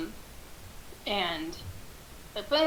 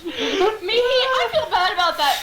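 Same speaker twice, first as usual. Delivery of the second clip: ordinary talk, nothing marked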